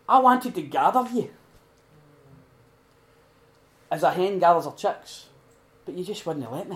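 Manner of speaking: Jesus weeping over Jerusalem, pleading lament. Retold or imitated. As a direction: cry it out over the city. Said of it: "crying, weeping".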